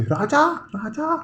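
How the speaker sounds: imitating the aunt screaming for Raja; retold shrill calling. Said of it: "फिर बहुत तेज तेज चिल्लाने लग गई".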